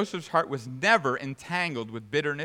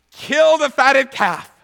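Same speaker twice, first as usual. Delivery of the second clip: loud, high, drawn-out command; exultant shout; 14 semitones higher, 18 dB louder, much slower than usual. Acted. Father ordering the feast for his returned son, exultant shout. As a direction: shout it slowly as a grand proclamation. And he says.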